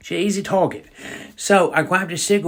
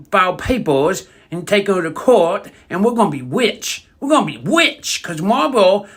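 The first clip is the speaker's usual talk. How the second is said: excited granny; 5 semitones higher than usual. Retold or imitated. Granny tells Jimmy how they'll get rich, worked up and rising. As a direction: get more and more worked up about getting rich. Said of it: "She's like".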